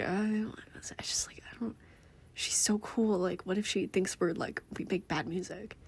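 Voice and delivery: hushed, hesitant doubt; nervous aside. Acted. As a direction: half-whisper it, stumbling with worry. Acting shy Casey doubting in aside.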